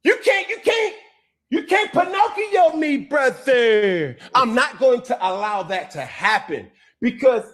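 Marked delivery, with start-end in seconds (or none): 0.0-4.2 higher, pressed defiance; heated. Real, not acted. Player refusing to be made into a fake, heated and higher. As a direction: push back hard.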